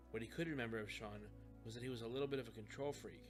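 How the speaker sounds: ordinary delivery, nothing marked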